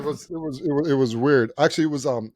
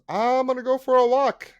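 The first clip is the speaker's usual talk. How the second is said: very high, louder put-on voice; older man excusing himself; 13 semitones higher, 4 dB louder than usual. Older guy telling receptionists he's leaving, high-pitched imitation. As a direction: announce it high, mocking his exit.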